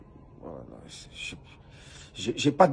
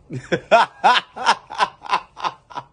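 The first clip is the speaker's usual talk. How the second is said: loud, high laughing fit that can't be held back; burst of laughter; 7 semitones higher, 6 dB louder than usual. Real, not acted. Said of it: "I'm laughing".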